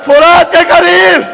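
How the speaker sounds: preacher crying out Husain's last call to his fallen companions, full force; impassioned preaching. Acted.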